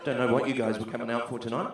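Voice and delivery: ordinary talk, nothing marked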